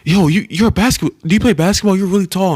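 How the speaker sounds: high, loud eager questioning; excited kid. Retold or imitated. Kid with a microphone excitedly asking about basketball. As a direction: sound thrilled at spotting a tall man. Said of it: "He was like".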